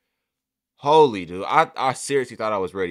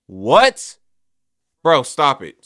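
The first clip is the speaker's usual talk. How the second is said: loud, higher, rising shock then protest; stunned disbelief; 4 semitones higher, 12 dB louder, much slower than usual. Real, not acted. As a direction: refuse loudly to believe it. Stunned by a game statistic.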